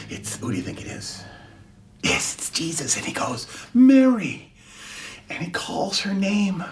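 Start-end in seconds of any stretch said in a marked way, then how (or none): 0.1-3.5 hushed, breathless whisper; suspense whisper. Acted. Teacher building suspense for the children, whispered. Suspense before Jesus appears. 3.7-4.5 calling her name. Acted. Jesus calls her name.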